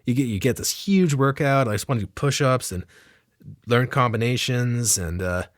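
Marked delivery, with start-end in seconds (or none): none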